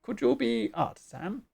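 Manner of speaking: loud, choppy bird-creature voice. Acted. Bird chief sizing up the stranger, squawky. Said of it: "doing the bird voice".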